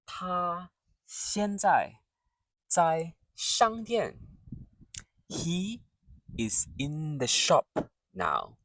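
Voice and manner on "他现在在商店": 他现在在商店 spoken slowly, word by word; slow careful model. Shown as a demonstration. Teacher slows the sentence for beginners, careful pace. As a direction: draw out each syllable for learners. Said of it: "Let's say a few example sentences".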